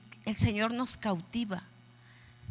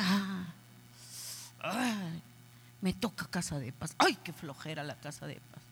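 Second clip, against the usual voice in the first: softer, lower, bored mock sigh; lazy whine; 5 semitones lower, 8 dB quieter than usual. Pastor imitating a lazy member, a bored mock whine. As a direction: drag it out like you can't be bothered. Member complaining about house meeting.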